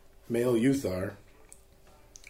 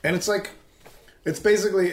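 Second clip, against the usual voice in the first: ordinary talk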